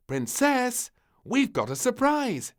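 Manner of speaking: high, loud calling voice; queen calling. A narrator's reading. Queen calling the princess for donkey rides, high loud. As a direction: call out the surprise. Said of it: "called the Queen".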